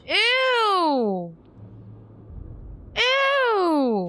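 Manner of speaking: grossed-out high squeal; disgusted ew. Real, not acted. Reacting to sisters twist.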